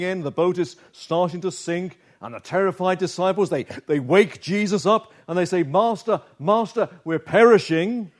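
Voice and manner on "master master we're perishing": raised, frightened plea for help; panicked cry. Retold or imitated. Terrified disciples crying out to the sleeping Jesus in panic. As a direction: cry out in panic as the boat sinks. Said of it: "Panic, fear".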